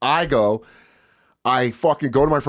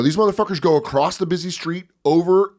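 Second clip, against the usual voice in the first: ordinary delivery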